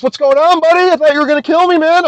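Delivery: high, mocking taunt at the rival; sing-song mock friendliness; cocky taunt. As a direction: needle him with a high, cocky greeting. Taunting the guy after the fight.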